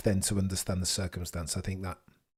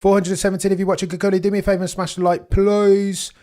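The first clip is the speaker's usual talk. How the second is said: higher and louder, rushing the ask; excited plea; 8 semitones higher, 7 dB louder, faster than usual. Real, not acted. Streamer hypes viewers to smash the like button, excited and loud. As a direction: hype the chat into smashing like. Begging chat for likes.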